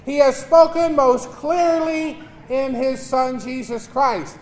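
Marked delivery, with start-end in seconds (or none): none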